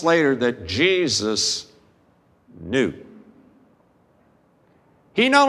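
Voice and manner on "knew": normal talking voice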